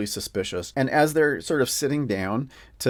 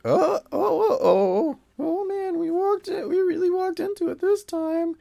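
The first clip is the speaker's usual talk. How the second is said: high-pitched mock wail of dismay; dismayed adventurers; 3 dB louder than usual. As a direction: play the whole party groaning in a high voice. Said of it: "everybody is like".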